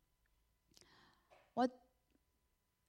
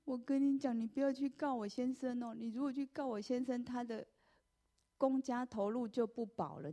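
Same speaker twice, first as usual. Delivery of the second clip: high, tearful mock plea; imitating a crying landlady; 6 semitones higher than usual. Landlady begs the teacher not to sue, tearfully. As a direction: voice the weeping landlady begging, pitched high. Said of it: "他就开始哭了".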